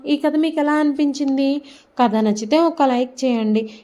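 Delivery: upbeat YouTube storyteller closing; channel outro. Real, not acted. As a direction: warmly invite viewers to like and subscribe. Channel outro asking for likes.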